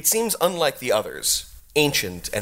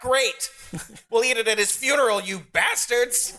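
ranting goblin villain; 11 semitones higher than usual. Acted. Goblin boss rants at heroes.

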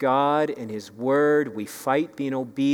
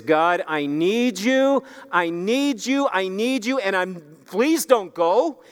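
pleading through tears; 6 semitones higher than usual. Acted. Jacob clinging to God in the night, crying out. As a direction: beg him not to leave, voice straining. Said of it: "he is crying".

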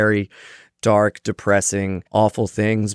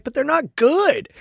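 imitating friend; slower than usual. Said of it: "He's like".

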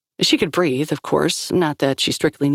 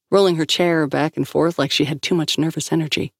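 ordinary delivery

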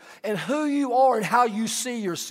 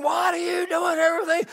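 higher, hammed-up yelling neighbor; mock angry tirade; 6 semitones higher than usual. Pastor imitating the cursing neighbor, hammed-up yell. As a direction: spit out the mock rant.

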